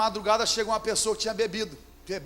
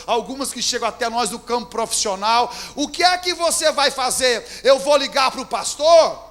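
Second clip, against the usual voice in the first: ordinary talk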